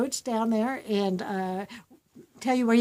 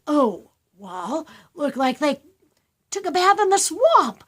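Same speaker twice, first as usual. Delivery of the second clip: put-on higher, louder eager kid voice; child's idea; 5 semitones higher, 7 dB louder than usual. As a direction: pitch the idea like a delighted child. Child voiced answering teacher.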